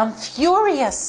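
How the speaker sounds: "furious" drawn out slow and weighty; emphatic furious. Shown as a demonstration. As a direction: lean into "furious" slowly.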